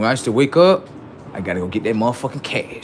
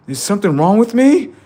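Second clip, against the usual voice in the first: mock panic; 10 semitones higher, 8 dB louder than usual. Acted panic.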